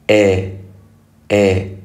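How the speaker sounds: إيه held as a clear "eh"; open "e" vowel. Shown as a demonstration. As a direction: model إيه as "eh" for listeners to copy. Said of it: "ركز على السمع".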